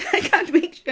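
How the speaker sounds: breaks into a teary laugh; real laugh with tears close behind; laughing through tears. Real, not acted. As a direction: laugh it off while welling up. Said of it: "I don't know why I'm flipping crying".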